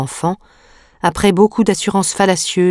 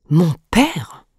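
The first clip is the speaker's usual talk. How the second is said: puzzled question, pitch leaping on the last word; a child's baffled question; perplexed exclamation. A narrator's reading. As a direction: cry it out in bewildered surprise. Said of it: "s'écria-t-il étrangement perplexe".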